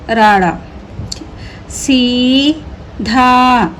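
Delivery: very slow, syllable by syllable; slow drawn-out reading. Shown as a demonstration. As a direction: stretch सीधा out slowly for learners. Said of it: "teacher stretches the word for pupils".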